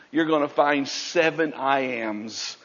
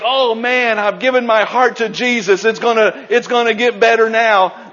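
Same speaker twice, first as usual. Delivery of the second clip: mock naive optimism; 6 semitones higher, 6 dB louder than usual. Mock hopeful new believer.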